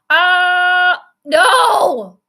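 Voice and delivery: loud, high groan of dismay. Real, not acted. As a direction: cry out as the play fails. Play goes wrong, dismay.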